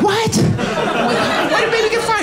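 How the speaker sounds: put-on can't believe the baby got fired; incredulous high-pitched what; shocked disbelief. Shocked at the news.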